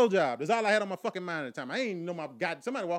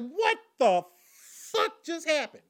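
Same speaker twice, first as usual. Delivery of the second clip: high, loud and slow, baffled; stunned disbelief; 11 semitones higher, 6 dB louder, much slower than usual. Retold or imitated. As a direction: drag it out in shock. Stunned after being dumped.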